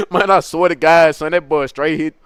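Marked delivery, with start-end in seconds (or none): none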